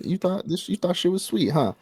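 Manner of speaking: mock her naivety; mocking taunt. Makima taunting Reze, high and mocking.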